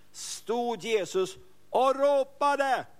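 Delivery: dramatic proclamation. Acted. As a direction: proclaim it like a trumpet call. Jesus crying out at the feast.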